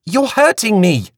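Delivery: high, whiny complaint; Flora's complaint. A narrator's reading. Flora complaining to Queenie about her grip. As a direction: protest the tight grip. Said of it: "Flora said".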